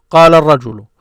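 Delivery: "قال الرجل" loud, run together as qaalar-rajulu; projected whole-phrase demo of "قال الرجل"; words joined. Shown as a demonstration. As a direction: link "قال الرجل" into one connected word. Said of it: "they break it down".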